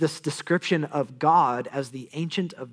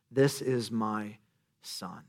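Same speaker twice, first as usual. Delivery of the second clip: hushed voice of God; 6 semitones lower, 8 dB quieter, much slower than usual. Acted. God's voice quoted, slowed down.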